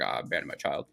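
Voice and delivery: low, reluctant imitation; mock grudging confession. Daniel made to confess in front of everybody, low mock admission. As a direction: drop low, as if forced to admit it.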